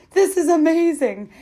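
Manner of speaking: high, loud, thrilled quote; excited quote. Retold or imitated. Runner mid-race beaming to his partner, high and loud. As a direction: mimic a runner who feels fantastic. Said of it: "he kept turning to me and going".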